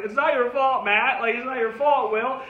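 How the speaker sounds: half-acted sob-choked pleading; a hint of bawling while repeating the line; mock crying plea. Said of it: "bawling, crying, like".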